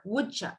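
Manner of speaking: "would ya" said as "wouldja"; reduced. Shown as a demonstration. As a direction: blend the d and y into a "j".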